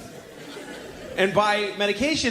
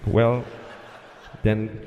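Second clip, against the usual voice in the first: low, halting, long pauses between words; dubious tower guy stalling; hesitant controller; 7 semitones lower, slower than usual. Acted. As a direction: give clearance reluctantly, drawing it out slowly. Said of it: "That's how he said it".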